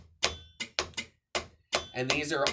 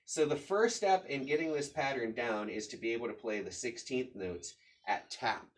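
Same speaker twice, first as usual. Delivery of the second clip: normal talking voice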